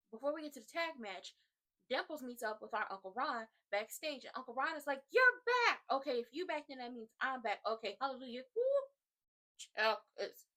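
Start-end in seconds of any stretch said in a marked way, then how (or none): 5.1-5.8 high, loud mock exclamation; excited greeting. Uncle Ron greeting Dimples backstage, in an excited imitated shout. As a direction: shout it high, acting thrilled.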